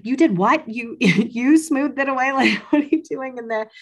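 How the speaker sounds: high, loud disbelieving question breaking into laughter; amazed, laughing. Retold or imitated. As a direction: play the midwife's delighted shock, laughing through it. Midwife amazed at the news.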